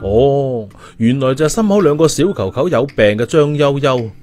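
ordinary delivery, nothing marked

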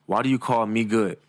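normal talking voice

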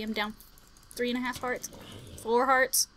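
ordinary delivery

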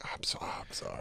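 whispered apology. Retold or imitated. Apologizing to fans in a guilty whisper. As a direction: breathe the apology without voice. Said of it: "And I'd be like".

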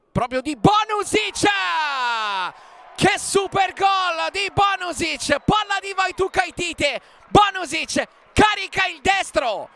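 excited shouted goal call; goal commentary shout. Real, not acted.

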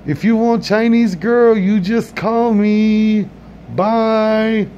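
high, slow put-on voice of the waiter; mock accented farewell. Comedian voices restaurant worker.

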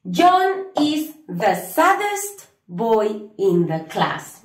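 slow, careful full English sentence; teacher giving the full answer for students. Shown as a demonstration. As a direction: say the whole answer slowly. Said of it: "vamos a decir".

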